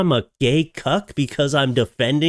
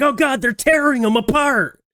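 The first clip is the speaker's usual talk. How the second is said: high-pitched cry of mock horror; hammed-up panic, voice shooting way up; mock horrified concern; 13 semitones higher, 3 dB louder than usual. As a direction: exclaim like watching a horror film. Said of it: "I was watching it concerned".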